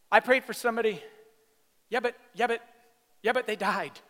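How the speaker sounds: loud, high mock "yeah, but"; doubting churchgoer. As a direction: mimic the skeptic's whiny objection. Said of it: "people going".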